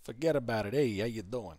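light Long Island New York accent; a hint of Long Island Italian-American accent. Shown as a demonstration. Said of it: "They've got that strong Long Island accent".

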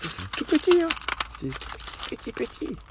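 squeaky high voice for something tiny; playful high-pitched petit petit. Acted. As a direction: go up high to show how small it is. Showing a tiny pill.

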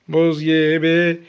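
low, very drawn-out words; teasing cold cue. Pretend. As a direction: stretch it out slowly and low. Hot-cold game, teasing cold cue.

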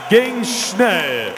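loud, high excited outburst; excited shout. Real, not acted. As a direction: blurt out amazed at the speed. Quick fight finish, loud.

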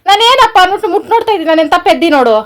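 high, loud voice of an aggrieved wife; indignant scolding. Real, not acted. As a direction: tell your husband off loudly. Wife scolds husband over shock.

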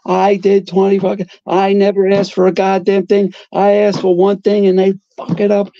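yelling at his son in full rage; furious screaming father. Retold or imitated. Father screaming at son about the transfer, high and furious. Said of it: "and he's screaming".

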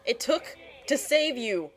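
exasperated scolding. Real, not acted. Scolding the rescued character.

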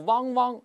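"汪汪" high and loud like a dog barking; dog-bark "wang". Shown as a demonstration. Teacher mocks students' tongue-root error, "汪汪" as dog bark. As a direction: bark out "汪汪" with a back "ng". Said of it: "像狗叫一样".

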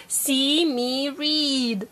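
drawn out, word by word; very slow reading. Shown as a demonstration. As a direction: model it slowly. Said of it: "So read the story again".

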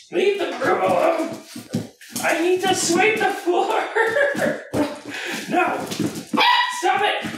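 high pet-talk pleading, a laugh in it; squeaky scolding of the dog. Real, not acted.

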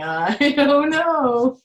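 dad voice. Retold or imitated. Teacher voicing Dad unsure about the car, slow and laughing. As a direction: drag it out like a baffled dad. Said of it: "there's Dad going".

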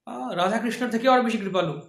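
ordinary delivery

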